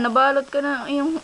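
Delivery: ordinary talk, nothing marked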